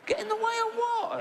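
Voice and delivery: high falsetto disbelief; incredulous falsetto; shocked squeak. Retold or imitated. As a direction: squeal the question in astonishment. Doctor incredulous in acted scene.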